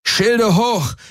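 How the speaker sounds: captain yells to raise shields; roaring order. Acted. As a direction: shout it over the battle. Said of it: "brüllte Garland".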